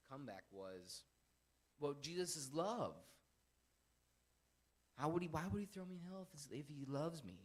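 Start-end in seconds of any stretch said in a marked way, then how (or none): 1.8-2.9 higher, louder, drawn-out objection; whiny comeback, pushed up and loud; mock protest. 5.0-7.4 high, loud protesting question; mock protest. Voicing an objector's comeback.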